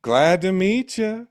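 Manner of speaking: high, slow, sweetly polite greeting; Reverend Kane. Acted. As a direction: stretch it out with pious, menacing politeness. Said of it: "a little bit of Reverend Kane".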